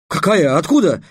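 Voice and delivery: high, loud startled questions; surprised. A narrator's reading. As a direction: ask in astonishment. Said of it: "удивился Трикс".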